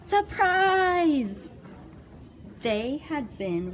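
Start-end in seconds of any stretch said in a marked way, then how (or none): 0.0-1.5 loud high surprise; surprise shout. Acted. New friends shouting surprise at the monsters. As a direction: jump out shouting. Kids jump out.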